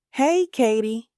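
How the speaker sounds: lightly called greeting; calling out. A narrator's reading. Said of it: "calls Ebony".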